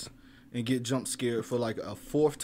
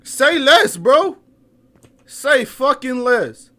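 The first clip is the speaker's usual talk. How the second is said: high, loud fed-up comeback; loud indignant retort; 9 semitones higher, 12 dB louder than usual. Real, not acted. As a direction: shout back at the ghost, offended. Retorting loudly to hostile ghost voice.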